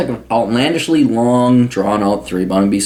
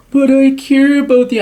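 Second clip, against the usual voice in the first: much higher, louder, sugary mock voice; mocking old hippie woman; 4 dB louder than usual. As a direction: ham up her syrupy plea for a hug. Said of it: "She's like".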